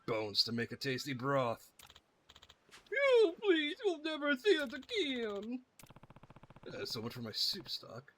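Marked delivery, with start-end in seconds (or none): 2.9-5.6 squeaky frightened begging; panicked high pleading. Acted. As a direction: beg for your life, high and shrill. Fleeing enemies beg in panic.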